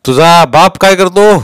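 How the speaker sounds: loud, high, slow question; domineering interrogator; police inspector. A narrator's reading. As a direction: press the boy sternly.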